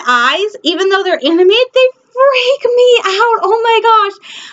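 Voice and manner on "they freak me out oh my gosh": mock creeped out. Confessing Thrawn's eyes scare her.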